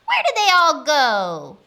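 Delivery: loud demanding call; witch shouting. A narrator's reading. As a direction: shout the question across a farmyard. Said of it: "Gritch shouted to a duck".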